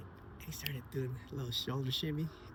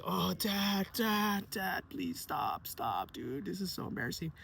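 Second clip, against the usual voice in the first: put-on embarrassed kid; 6 semitones higher, slower than usual. Embarrassed son begging dad.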